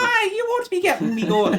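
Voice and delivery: very high, loud mock-Irish leprechaun; leprechaun Irish accent. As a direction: squeal it in a cartoon Irish accent. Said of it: "that's a leprechaun accent".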